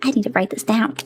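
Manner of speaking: ordinary talk, nothing marked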